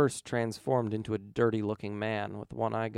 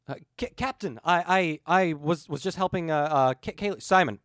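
louder, stumbling, caught out; flustered stammer; 4 semitones higher, 7 dB louder than usual. Acted. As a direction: trip over every word.

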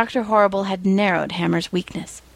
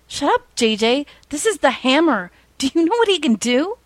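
rising higher, in awe; awed robber; 7 semitones higher than usual. A narrator's reading. Robber telling JJ about the Hammer, rising in awe.

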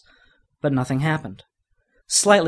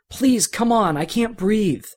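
pleading; 8 semitones higher, slower than usual. Acted. Pinned down, can't breathe.

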